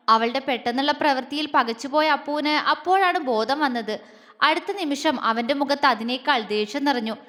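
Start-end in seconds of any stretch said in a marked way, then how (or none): none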